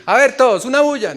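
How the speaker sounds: high, loud call for a racket; a hammed-up shout to the crowd; mock noisy command. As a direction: order everyone to make noise. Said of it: "No era decir".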